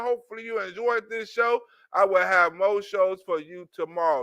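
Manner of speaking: normal talking voice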